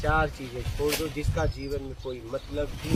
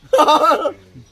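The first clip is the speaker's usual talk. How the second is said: loud, high burst of laughter; short hearty laugh; 10 semitones higher, 12 dB louder than usual. Real, not acted. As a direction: burst out laughing mid-story. Laughs mid-story.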